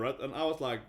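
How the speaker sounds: usual speaking voice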